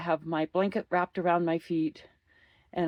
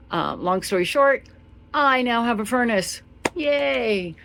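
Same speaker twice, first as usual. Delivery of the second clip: much louder, higher joyful news; excited; 7 semitones higher, 8 dB louder than usual. Real, not acted. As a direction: announce it with delight. Happy furnace news.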